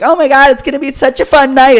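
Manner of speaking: high, loud, gushing squeal; hammed-up party-girl excitement; mock excited girls. College girls heading out for the night, mock excited.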